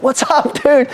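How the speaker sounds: put-on high, overjoyed door-to-door kid; best-day-ever greeting. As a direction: imitate a super-cheerful young salesman's hello. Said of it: "having the best day of his life".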